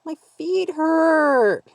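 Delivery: put-on high, slow, whining child's voice; whiny little girl. A narrator's reading. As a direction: whine like a tired little girl. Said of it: "the little girl whined".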